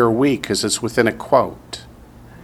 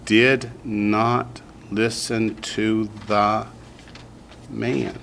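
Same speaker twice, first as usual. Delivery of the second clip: staccato; 3 dB quieter, much slower than usual. Shown as a demonstration. Teacher reads sentence word by word.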